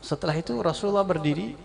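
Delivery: ordinary talk, nothing marked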